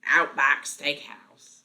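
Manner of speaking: slow, loud Australian ad-style voice; mock Australian accent. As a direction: announce it in hammed-up Australian. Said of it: "I always just imagine".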